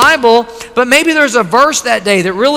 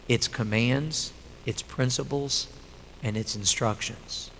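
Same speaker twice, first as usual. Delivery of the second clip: low, soft and intense, trailing quieter; soft earnest; 6 semitones lower, 10 dB quieter than usual. Real, not acted. Pastor urging the congregation softly, low and earnest. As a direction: press the point gently, almost under the breath.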